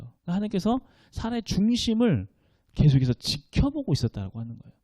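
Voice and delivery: ordinary delivery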